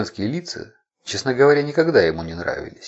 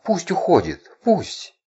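high rising moan of passion; 11 semitones higher, slower than usual. A narrator's reading. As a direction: cry it out in passion. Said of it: "стонала она".

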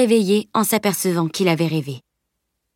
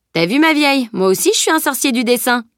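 higher, gleeful boast; triomphant; 6 semitones higher than usual. Acted. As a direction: crow over a small victory. Boy leaps up, boasting to friend. (vantardise joyeuse et triomphante)

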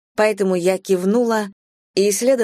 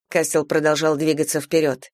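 ordinary delivery

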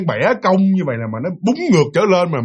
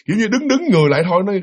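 usual speaking voice

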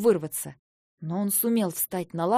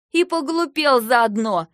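loud, higher angry retort; heated cry; 4 semitones higher, 8 dB louder, slower than usual. A narrator's reading. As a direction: cry it out in a temper, stretching the words. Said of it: "в сердцах выкрикнула я". (выкрикнуть в сердцах)

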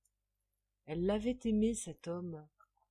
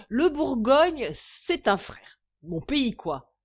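put-on loud, high, emphatic drunken praise; tipsy hiccuping drunk; 5 semitones higher, 10 dB louder than usual. A narrator's reading. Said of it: "hoquetait J B". (ivrogne lyrique, emphatique)

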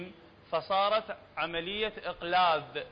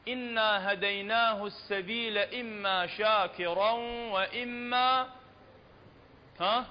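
Quran recitation; slower than usual. Shown as a demonstration. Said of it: "recites verse for class".

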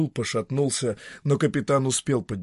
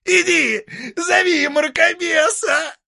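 high, creaky devil voice; a creaky, mocking old devil; 8 dB louder, slower than usual. Devil Dieter.

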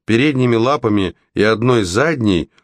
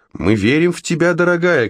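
high-pitched call from above; encouraging shout; 9 semitones higher than usual. A narrator's reading. Froggy shouts down his faith in Red, encouraging. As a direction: cheer down to someone in a high voice. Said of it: "кричал сверху Фрогги".

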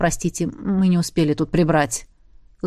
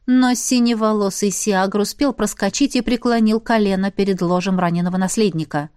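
usual speaking voice

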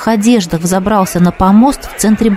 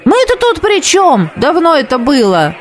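higher, indignant crowd voice; indignant cry; 7 semitones higher than usual. Acted. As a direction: brush off the blame. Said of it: "раздались ответные крики". (выше, возмущённо)

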